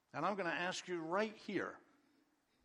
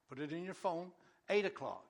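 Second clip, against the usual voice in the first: ordinary talk, nothing marked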